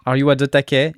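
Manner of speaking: "Are you at the taquet?" with "taquet" said in an English accent; mock English accent. Shown as a demonstration. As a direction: Frenchman hamming up English pronunciation. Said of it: "des phrases françaises que je vais prononcer à l'anglaise".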